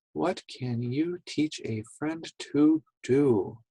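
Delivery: soft, measured reading; quiet read-along. Shown as a demonstration. Teacher lets children read along, quiet read-along. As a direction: read gently under the children's voices.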